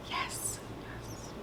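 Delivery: very soft whispered confirmation; hushed whisper. Real, not acted. Storyteller confirms a twist to viewers, in a hushed whisper. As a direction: whisper it, letting it fade almost to nothing.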